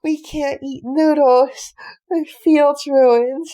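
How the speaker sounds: loud, slow wailing sob; crying. Acted. Young son sobs loudly over the ruined field. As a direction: wail it out through tears. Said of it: "Stop crying".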